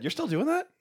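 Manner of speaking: squeaky incredulous mimic; high, soft, disbelieving imitation; mocking high voice. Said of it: "a lot of people said".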